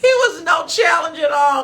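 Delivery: high, loud gloating; laughing triumph; gleeful. Real, not acted. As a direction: crow about the win.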